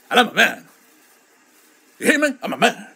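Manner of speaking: loud, swooping comic indignation; mock macho protest. Streamer refusing pink clothing, loud mock-macho outburst. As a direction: insist on your manhood, hammed up.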